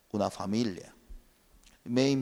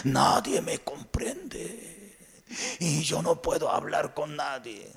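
slow whispered self-pity; isolated believer whispering; lonely whisper; 3 semitones higher, 4 dB quieter, slower than usual. Acted. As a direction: murmur it as if no one listens.

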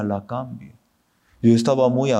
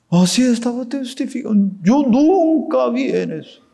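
much higher, louder, amazed and fast; amazed high exclaim; 10 semitones higher, 3 dB louder than usual. Real, not acted. Pastor marvels at her testimony, high amazed exclaim. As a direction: burst out in wonder at her freedom.

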